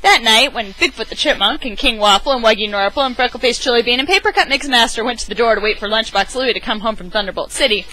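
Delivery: ordinary delivery, nothing marked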